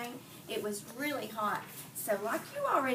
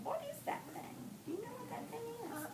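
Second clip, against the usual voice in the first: much higher, soft questioning voice; curious quiz; 13 semitones higher, 9 dB quieter, faster than usual. Real, not acted. Teacher quizzing kids.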